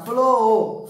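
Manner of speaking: FLOR with the vowel stretched long; long, sustained "o" in FLOR; imitating children's drawn-out word. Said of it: "Eles costumam falar".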